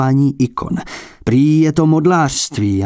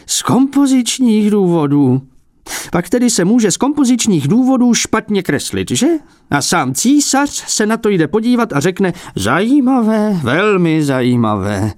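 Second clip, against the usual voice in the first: higher, scornful echo of the excuse; indignant mockery; 9 semitones higher than usual. A narrator's reading. Old abbot mockingly repeats the collector's excuse, higher. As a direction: throw his words back at him in scorn.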